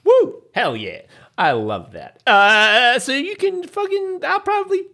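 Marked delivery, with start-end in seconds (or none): 0.0-1.0 loud high whoop of joy; cheering. Real, not acted. Cheering at good news. 2.3-4.8 excited. Real, not acted. Excited after the invite.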